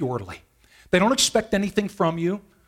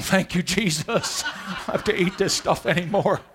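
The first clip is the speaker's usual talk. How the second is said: relieved dog, laughing through it; 2 semitones higher than usual. Retold or imitated. Dog's voice, relieved.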